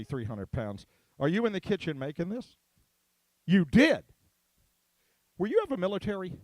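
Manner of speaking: normal talking voice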